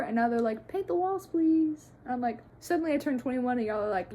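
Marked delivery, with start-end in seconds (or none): 0.7-1.9 high mock begging; pleading parents. Parents now asking for painted walls, mock pleading. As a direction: imitate parents wheedling, pitched way up.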